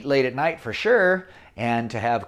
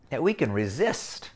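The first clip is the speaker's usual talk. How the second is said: usual speaking voice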